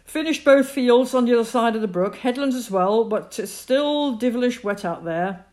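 high, loud, booming report of the day; hearty farmer father. A narrator's reading. Father comes in from the fields, loud and content. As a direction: call it out content from the doorway.

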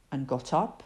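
ordinary delivery, nothing marked

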